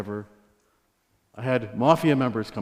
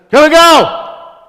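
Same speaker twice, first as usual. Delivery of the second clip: high, loud, drawn-out barked order; mock stern warden shouting an order; stern warden's bark; 17 dB louder, much slower than usual. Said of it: "she talked just like that".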